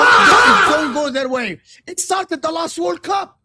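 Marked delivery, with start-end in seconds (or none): 0.0-1.6 ranting shout. Real, not acted. Ranting about whining team.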